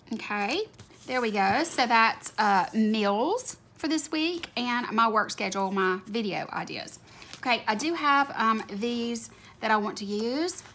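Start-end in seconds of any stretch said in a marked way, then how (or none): none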